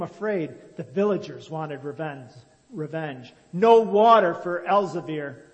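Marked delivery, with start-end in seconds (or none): 2.7-5.5 much louder angry chant; crowd shout. A narrator's reading. Villagers demand revenge on Elzevir, loud shouted chant. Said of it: "they shouted".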